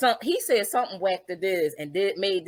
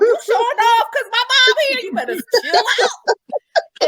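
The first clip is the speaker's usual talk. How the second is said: mock warning; 12 semitones higher, 7 dB louder than usual. Kid warning dad he's showing off, loud teasing. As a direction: tell him to cool it, half laughing. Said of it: "I'm saying to myself".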